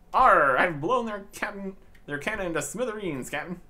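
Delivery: loud hammed-up pirate growl, slowed. Lad boasting to his captain in a hammed-up pirate voice. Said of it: "Stop talking like that".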